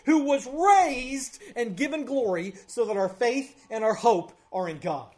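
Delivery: loud, high, ringing sermon climax; impassioned preaching. Real, not acted. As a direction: preach it out with full force, driving each phrase home. Preaches at full force.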